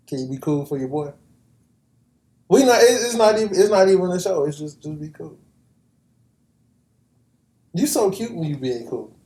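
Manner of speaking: ordinary delivery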